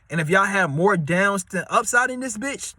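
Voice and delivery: ordinary talk, nothing marked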